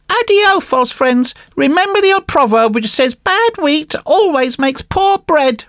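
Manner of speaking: high-pitched puppet voice, brushing them off; dismissive marionette. Acted. Marionette rebuffs the false friends, high and dismissive.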